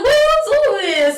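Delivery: high, slow what are you doing; best friend baffled by her giggle; incredulous. Retold or imitated. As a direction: pitch it up in disbelief. Staring at friend in disbelief.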